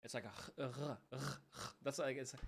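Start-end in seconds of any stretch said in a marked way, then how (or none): 0.2-1.2 soft, rasped r from the back of the throat, repeated; French R. Shown as a demonstration. French speaker explaining R's are said differently, soft French R. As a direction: repeat r as a quiet uvular scrape. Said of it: "said a different way".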